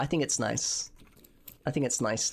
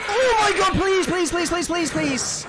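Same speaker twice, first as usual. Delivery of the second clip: high panicked pleading, getting louder; begging for your life, voice climbing in fear; terrified horror victim; 13 semitones higher, 6 dB louder than usual. Real, not acted. Panicked pleading during horror game chase.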